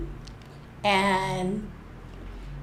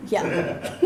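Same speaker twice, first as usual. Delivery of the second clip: ordinary talk, nothing marked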